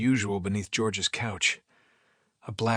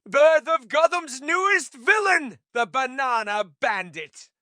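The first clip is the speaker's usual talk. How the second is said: grand announcer; 13 semitones higher, 6 dB louder, slower than usual. Acted. Jerry mock announces villain.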